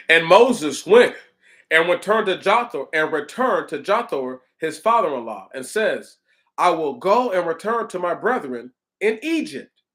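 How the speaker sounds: emphatic, measured Bible recitation; scripture reading. Acted. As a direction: read out the verses as solemn proclamation. Resumes reading scripture aloud.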